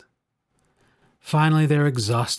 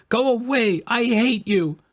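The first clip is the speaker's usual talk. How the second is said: put-on high child's angry cry, much higher; shouted; 9 semitones higher than usual. A narrator's reading. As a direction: yell it at grandfather in a tantrum. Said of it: "On shouted".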